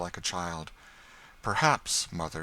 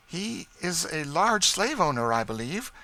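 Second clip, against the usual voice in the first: higher, louder, keen despite herself; barely hidden interest; 11 semitones higher, 5 dB louder than usual. A narrator's reading. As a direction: let too much interest slip through. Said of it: "betray more interest than she was exactly willing to show".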